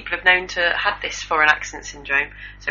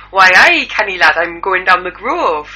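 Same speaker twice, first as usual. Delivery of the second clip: hammed-up Geordie accent, punched at the start; Geordie impression; 6 dB louder than usual. Co-host showing how the stroke woman sounded before, in mock Geordie. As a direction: put on a broad Geordie accent. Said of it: "This is how she sounded before".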